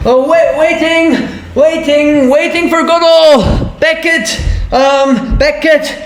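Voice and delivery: high, excited, rushed; frantic eureka. Acted. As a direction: grab the idea in a panic. Frantic realization of escape route.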